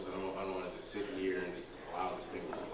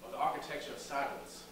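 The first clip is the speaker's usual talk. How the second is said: higher, impassioned stage delivery with long pauses; dramatic monologue; 6 semitones higher than usual. Acted. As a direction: recount a lost love to an audience, pausing for weight.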